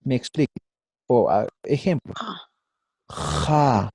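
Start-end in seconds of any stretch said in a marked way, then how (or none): 3.1-3.9 "Ja" with a long rasped fricative J; scraped J. Shown as a demonstration. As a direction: scrape the throat on the J of "Ja". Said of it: "pero raspada".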